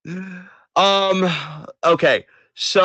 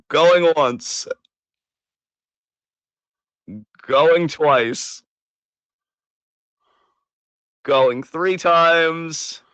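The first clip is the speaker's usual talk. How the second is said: mock auction countdown with drawn-out ending; playful auctioneer call, stretching the final count; auctioneer's last call; much slower than usual. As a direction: call it out like closing bids. Auctioneer-style last call for questions.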